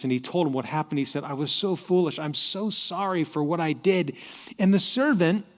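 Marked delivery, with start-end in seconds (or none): none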